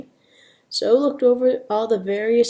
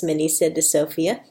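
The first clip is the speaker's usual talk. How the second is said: usual speaking voice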